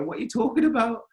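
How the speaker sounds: high, incredulous question; pushed up in disbelief; retold indignant reply. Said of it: "I go".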